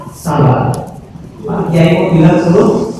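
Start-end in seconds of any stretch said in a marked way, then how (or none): 0.1-0.7 low falling sabar; calm low. Shown as a demonstration. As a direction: let sabar fall low. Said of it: "guru ngomong".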